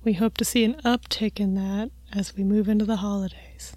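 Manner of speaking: softly murmured public radio report; light parody of a quiet radio host; mock NPR read. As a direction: play the hushed radio host, sliding into a whisper. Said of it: "N P R style talking, like the low talking".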